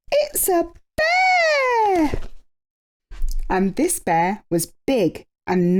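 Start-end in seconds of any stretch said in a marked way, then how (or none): 0.1-2.2 frightened cry. A narrator's reading. Bees hearing the bear's crash, a frightened cry. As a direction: cry out in terror. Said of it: "they cried".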